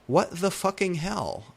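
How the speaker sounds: an exasperated demand; angry. A narrator's reading. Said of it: "I asked angrily".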